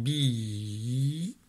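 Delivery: "bi" with a very long i; slowly sung-out "bi", vowel held; sounding out. Shown as a demonstration. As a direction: stretch the vowel of "bi", gliding up. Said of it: "J'entends le I".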